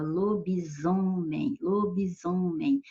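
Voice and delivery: "lobisomem" said slowly, with the S voiced as a clear Z; demonstrating the Z sound. Shown as a demonstration. Teacher sounding out "lobisomem" for students, slow and clear. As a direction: draw out "lobisomem" so the Z sound stands out. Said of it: "mas tem o som de Z".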